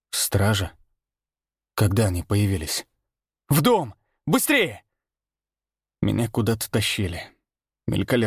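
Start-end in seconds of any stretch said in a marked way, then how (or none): 3.5-4.8 high, urgent order; urgent command. A narrator's reading. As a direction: bark the order to hurry. Rough voice gives orders.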